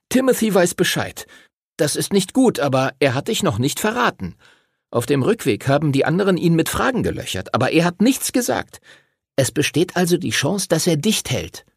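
put-on high-pitched boy laying out points; Arthur, insistent boy. Arthur lists facts for his friends, high insistent voice. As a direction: reason it through in a high, urgent boyish voice.

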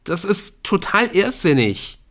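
high, loud disbelief; exasperated, despairing outburst; desperate protest. A narrator's reading. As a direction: protest it as if cornered. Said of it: "Verzweiflung in Eriks Stimme".

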